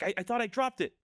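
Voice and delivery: high, stammering mock panic; freaking out. Boy finds the trinket back in his hand, retold panic. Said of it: "He, like, freaks out".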